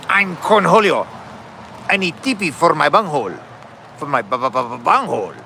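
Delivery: manic put-on cartoon voice with stuttered syllables; Cornholio. Cartoon character demanding things, stuttering manic impression. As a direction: declare yourself in a strange voice, then stammer the last word. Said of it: "I just did the impersonation perfectly".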